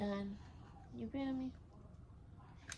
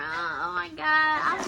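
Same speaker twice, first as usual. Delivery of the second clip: excited; 4 semitones higher, 7 dB louder, slower than usual. Real, not acted. Excited opening new camera.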